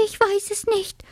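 very high, frightened little mouse voice; trembling whisper. A narrator's reading. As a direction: squeak it timidly, trembling all through. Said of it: "flüsterte die Haselmaus und zitterte".

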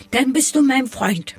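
high, delighted snowflake call; joyful little snowflake voice; happy calling out. A narrator's reading. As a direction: call out high to a new friend. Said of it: "rief die Schneeflocke".

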